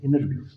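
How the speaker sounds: "इंटरव्यू" said as "innerview"; no T after the N in "इंटरव्यू"; American-style "इंटरव्यू", T left out; American silent T. Shown as a demonstration. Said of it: "टी की आवाज़ नहीं देते".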